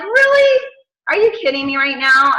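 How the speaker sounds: high incredulous really; put-upon, high-pitched question; exasperated disbelief. Retold or imitated. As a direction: squeak it in mock disbelief. Retold exasperation at whining dog.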